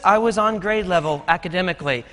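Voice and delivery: ordinary talk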